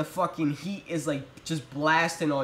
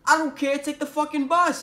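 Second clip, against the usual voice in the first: higher, louder mock mom refusal; mom snapping; 8 semitones higher, 4 dB louder than usual. Mom on the phone refusing taxi money, loud and high. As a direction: play the fed-up mom.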